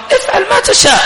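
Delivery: very high-pitched mock go-ahead; high, scornful be-my-guest; sarcastic permission. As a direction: throw the voice way up, ironically permissive.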